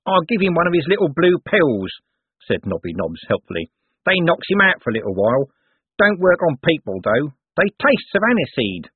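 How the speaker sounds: the watchman offering a cheerful tip; helpful Nobby Nobbs. Acted. Corporal Nobbs speaks.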